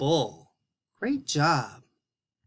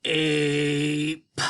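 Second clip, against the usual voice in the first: puppet sounding out. Pretend. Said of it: "Can you do that again, [name]?".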